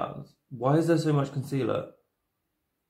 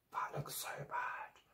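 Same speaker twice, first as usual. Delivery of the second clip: whisper; 20 dB quieter, slower than usual. Real, not acted. Drunk makeup going wrong.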